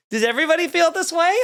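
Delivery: high, quick startled question; sudden doubt. Retold or imitated. Retelling his girlfriend's shocked realisation as a quick, high blurt. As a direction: blurt it out like it just dawned on her.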